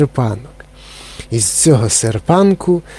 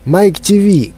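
shouting; 6 semitones higher, slower than usual. A narrator's reading. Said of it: "крикнув містер Вонка".

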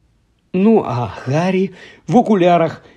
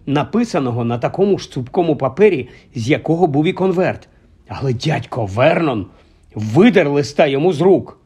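ordinary delivery

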